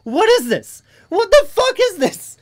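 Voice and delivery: loud question climbing very high; high, astonished yell; genuinely surprised. Real, not acted. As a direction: cry out in rising disbelief. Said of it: "I legit am fucking surprised".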